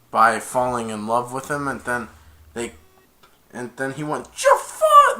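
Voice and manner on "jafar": very high, loud squawk; Iago squawk. Retold or imitated. Iago calling to his master, high loud parrot squawk. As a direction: screech the name like a parrot. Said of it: "That's what Iago said".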